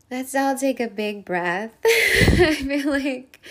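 teary laugh through crying; tearful. Real, not acted. Speaking to listeners while tearful, laughing through tears. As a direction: let the tears break into the words.

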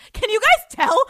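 loud, high, giddy question; really excited. Real, not acted. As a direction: burst out at the listeners. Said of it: "I'm just really excited".

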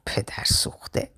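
slow, soft whisper; under the breath. A narrator's reading. Ali grumbling about the cheeky boy, whispered under breath. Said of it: "علی زیر لب گفت".

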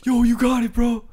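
high-pitched, imitated cheering-on; hype man. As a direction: hype up your teammate like a cheerleader. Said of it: "Now I'm more like hype man".

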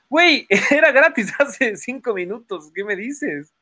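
high-pitched incredulous protest with a laugh; mock outrage. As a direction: blurt it out in disbelief, half laughing. Acts out his outraged reply. (protesta incrédula y aguda)

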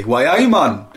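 hammed-up Geordie hello. Mock UK chart host, loud hammed-up Geordie. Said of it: "I'll just pick one for the U K".

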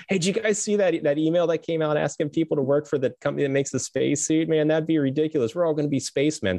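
high, excited joking college buddy; mock buddy banter. Buddies joking over wings, high excited mock voice. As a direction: ham up the goofy buddy at the bar.

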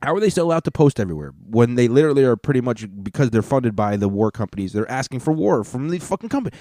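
fast, rising outrage; exasperated rant. Real, not acted. Podcaster ranting about war-funded media, fast exasperated. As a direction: rattle it off, climbing to a high incredulous finish.

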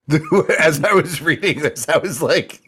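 high, loud laughing talk; can't stop laughing. Real, not acted. Laughing at the post.

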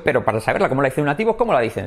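usual speaking voice

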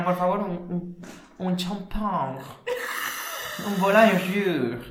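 put-on slow, drawn-out French accent; French guest voice. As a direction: order like a French hotel guest. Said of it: "me llega a mí un francés en el hotel".

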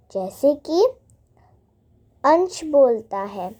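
ordinary delivery

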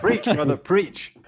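high, hammed-up preach brother; mock cheer. Egging on the guest with mock church cheer.

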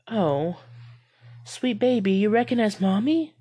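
high, loud sugary mother voice; mock mother. A narrator's reading. Skinwalker wearing her mother's face, mocking coo.

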